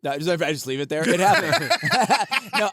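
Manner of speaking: ordinary delivery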